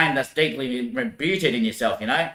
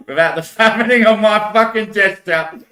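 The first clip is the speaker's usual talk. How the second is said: louder and higher, laughing through it; laughing rant; 8 semitones higher, 8 dB louder than usual. Real, not acted. Ranting about seated positions, bursting into laughter. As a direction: crack up mid-sentence.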